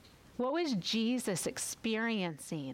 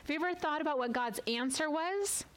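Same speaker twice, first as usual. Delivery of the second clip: ordinary talk, nothing marked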